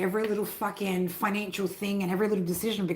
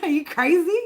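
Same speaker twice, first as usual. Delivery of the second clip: laughing, higher and louder; laughing disbelief; 7 semitones higher, 5 dB louder than usual. Real, not acted. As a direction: laugh through the question.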